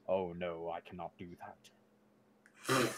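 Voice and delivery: low, muffled mock-divine voice; echoing voice. Shown as a demonstration. Player showing the group a makeshift echo, muffled low voice. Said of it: "I, like, cover my mouth".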